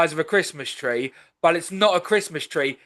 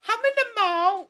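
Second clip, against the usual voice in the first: high falsetto quote; mock accent. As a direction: squeak it way up high as a joke bit. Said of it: "That's the cancel bit".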